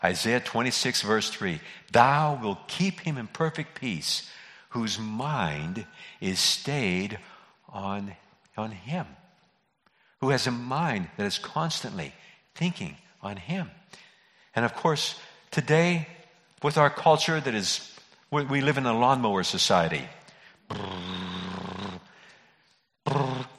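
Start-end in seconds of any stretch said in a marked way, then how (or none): 1.9-9.1 slow, reverent reading; scripture reading. Acted. Rereads scripture slowly. 20.7-23.5 buzzing lips like a mower engine; lawnmower noise. Retold or imitated. Imitating a lawnmower sound.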